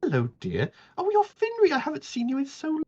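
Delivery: put-on high, scandalised then doting old lady; Miss Polly's voice. GM as Miss Polly scolding Sandal and fussing over Finri, high. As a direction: scold the rude boy, then coo over the guest.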